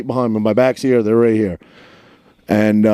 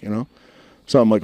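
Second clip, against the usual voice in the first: ordinary talk, nothing marked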